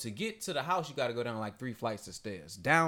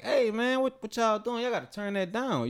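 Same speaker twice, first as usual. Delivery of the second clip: higher-pitched retold challenge to noisy strangers; imitated confrontation; 10 semitones higher than usual. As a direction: act out a cousin stepping up to them. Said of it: "He went down there with the shits".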